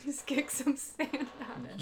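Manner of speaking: slow, amused words with a laugh. Real, not acted. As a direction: chuckle through the line. Repeating the line, laughing.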